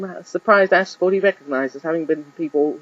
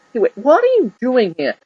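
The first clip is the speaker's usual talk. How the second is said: put-on higher puzzled boy voice; surprised boy; 8 semitones higher than usual. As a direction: catch her unexpectedly. Ash surprised by mother.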